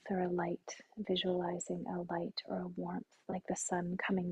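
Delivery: soft, soothing meditation voice with long pauses; guided meditation. Acted. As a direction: gently guide them through relaxing the body.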